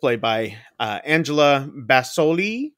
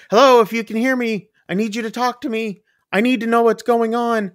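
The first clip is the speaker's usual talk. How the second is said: higher, louder pleading operator; urgent operator; 9 semitones higher, 4 dB louder than usual. Retold or imitated. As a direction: press the caller for an answer. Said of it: "from the phone operator".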